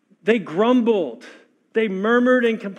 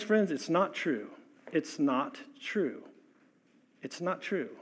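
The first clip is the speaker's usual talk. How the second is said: each repeat softer and softer; quiet, fading insistence; fading to near silence; 3 semitones lower, 10 dB quieter than usual. Real, not acted. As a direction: let it die away to a hush. Sermon point, repeated, dropping.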